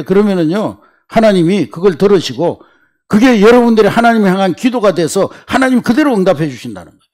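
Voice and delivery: ordinary talk, nothing marked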